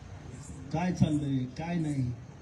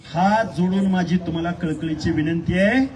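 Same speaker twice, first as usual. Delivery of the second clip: loud, emphatic public appeal; forceful plea; 4 semitones lower, 7 dB louder than usual. Real, not acted. Speaker repeating a folded-hands plea to the crowd, loud and emphatic. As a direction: ring the plea out to the whole crowd.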